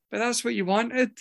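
a hint of a matter-of-fact wife; imitating wife.

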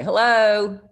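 a mock-posh British hello; British accent. Answering the guy's call in a put-on British accent. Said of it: "putting on a British accent".

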